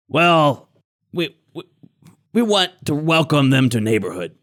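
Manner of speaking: halting, broken-speech hobgoblin excuse; evasive hobgoblin. Acted. Hobgoblin explaining the captives to suspicious visitors, stalling. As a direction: stumble through a cover story as a hobgoblin.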